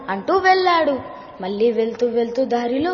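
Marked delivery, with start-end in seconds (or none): none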